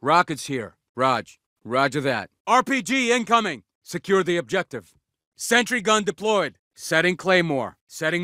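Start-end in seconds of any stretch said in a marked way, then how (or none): 2.5-3.6 high alarm call; raised-pitch combat shout; urgent warning. Acted. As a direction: warn the squad mid-battle.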